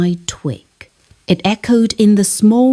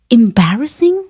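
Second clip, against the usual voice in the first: plain reading voice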